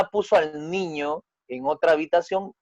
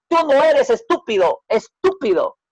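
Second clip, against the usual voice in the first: high, louder exasperated father; angry father calling his son stupid; 10 semitones higher, 5 dB louder than usual. Acted. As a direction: snap it angrily at the boy. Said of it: "el padre enojado".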